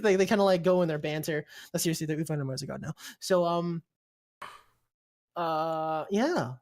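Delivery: ordinary delivery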